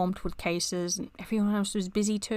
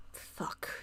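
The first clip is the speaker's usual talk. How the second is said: whispered curse; 13 dB quieter than usual. Real, not acted. Reaction to being scolded.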